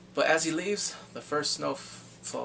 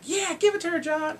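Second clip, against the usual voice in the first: high, raised cheering voice; mock cheering; 14 semitones higher, 3 dB louder than usual. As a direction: egg him on in a pretend cheer.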